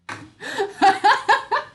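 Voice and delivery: sudden high giggling laugh; high burst of laughter. Real, not acted. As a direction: let out a loud high laugh. Husband's joke cracks her up.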